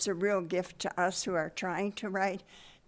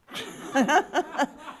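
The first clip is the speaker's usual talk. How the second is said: high, louder burst of laughter; hearty laugh, pitched high; amused laugh; 9 semitones higher, 6 dB louder than usual. Real, not acted. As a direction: laugh out loud at the punchline. Joke about friend's lie lands.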